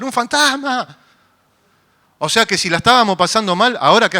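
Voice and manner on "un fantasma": high startled shout of ghost; frightened cry. Retold or imitated. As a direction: cry out like a terrified fisherman. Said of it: "empezaron a gritar". (grito asustado, agudo)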